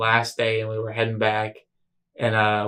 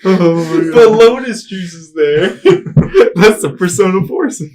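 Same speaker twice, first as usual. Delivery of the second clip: high, loud, breaking into laughs; cracking up recalling Chase's mixup; laughing hard; 8 semitones higher, 11 dB louder than usual. Real, not acted. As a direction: squeal it through laughter. Laughing at the story.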